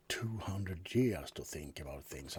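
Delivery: hushed whisper of ancient age. Acted. Vampire whispering his age to his roommate. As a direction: whisper it like a secret.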